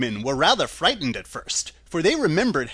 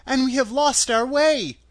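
put-on high, louder little girl voice; girl's voice, high and louder; child; 9 semitones higher, 5 dB louder than usual. A narrator's reading. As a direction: plead in a child's raised pitch. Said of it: "said the child".

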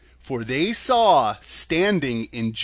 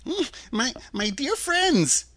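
high, slow, stammering; faltering, high old-man voice; pleading little man; 11 semitones higher, slower than usual. A narrator's reading. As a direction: appeal timidly to the group.

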